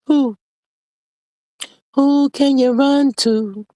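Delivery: high, slow taunting who; mocking who. Real, not acted. Teasing the viewers about who she runs to, high and slow. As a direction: taunt her, drawn out and high.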